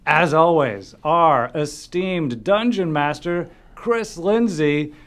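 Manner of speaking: loud ring announcer hype; wrestling announcer. Acted. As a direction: introduce fighters like a ring announcer. Wrestling style announcer intro.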